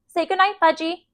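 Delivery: high sing-song mom voice; coaxing mother. A narrator's reading. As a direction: coax a toddler in a high voice. Mother coaxes toddler to bed.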